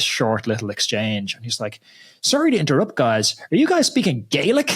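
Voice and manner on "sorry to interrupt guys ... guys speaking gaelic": high, swooping mock-polite query; imitating a Canadian bystander. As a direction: mimic a curious Canadian stranger butting in. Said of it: "some Canadian kind of bystanders".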